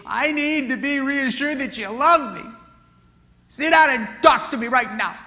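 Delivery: high, loud, insistent demand; a wife demanding to be reassured, right now. Retold or imitated. Wife pressing her husband to talk, loud demand.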